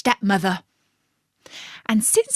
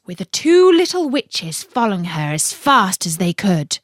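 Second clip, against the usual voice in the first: usual speaking voice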